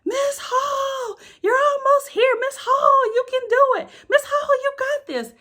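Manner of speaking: very high, loud cheering voices; kids cheering. Retold or imitated. Students cheering their teacher up the canyon: high, loud cheer. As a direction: call out to her from the top. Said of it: "they were saying".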